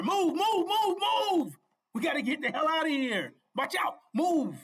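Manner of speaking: high, loud commands to move; urgent shouting. Acted. Preacher playing rescuer in a burning house, urgent shout. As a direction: yell to get everyone out now.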